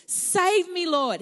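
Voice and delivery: high, loud desperate cry; cried out. Acted. Sinking Peter crying to Jesus, desperate cry. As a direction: beg for rescue as you sink. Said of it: "he cried out".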